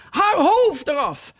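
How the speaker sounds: high, slow queen's order; queen shouting. A narrator's reading. Queen shouting at the players, high and slow. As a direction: bellow the sentence. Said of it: "en roepen".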